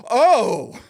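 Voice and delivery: high, loud, drawn-out "oh"; sudden realization. Retold or imitated. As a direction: act out the penny dropping. Acted guy suddenly understanding.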